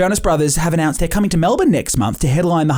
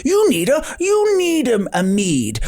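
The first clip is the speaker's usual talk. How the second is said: lightly voiced cheery ride host, pitched up; theme park staffer in character; 7 semitones higher than usual. Staff member welcoming riders in character, pitched up. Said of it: "So they're like".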